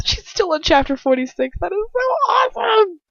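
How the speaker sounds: rising to a very high squeal; over-the-top high excitement; mock gushing. Gushing over the chapter count.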